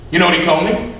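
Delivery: usual speaking voice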